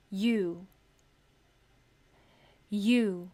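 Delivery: यू said as a long you glide; long u sound. Shown as a demonstration. Teacher modelling यू for her class. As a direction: say यू slowly, y into oo. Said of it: "फिर है long यू का sound".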